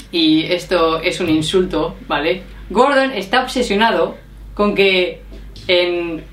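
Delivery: usual speaking voice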